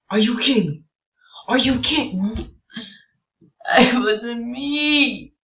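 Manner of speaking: high, loud indignant yelling; real outraged protest. Real, not acted. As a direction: protest your innocence at full volume. Protesting being accused.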